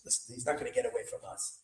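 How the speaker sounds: hushed, fast whisper; conspiratorial whisper. Retold or imitated. Gang leader whispering to his followers, hushed and fast. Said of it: "he says".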